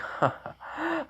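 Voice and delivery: short high-pitched acted laugh; a soft, high scoffing laugh; put-on laugh in character; mock laugh. Acts out her scoffing laugh.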